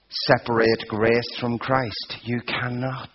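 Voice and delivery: normal talking voice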